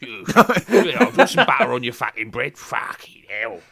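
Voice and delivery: mock rough southern everyday voice; rough southern English. Showing his un-posh real voice, rough southern accent. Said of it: "if you speak to me in person, I'm like".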